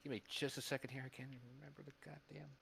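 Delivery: ordinary talk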